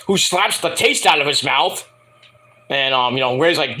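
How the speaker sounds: ordinary delivery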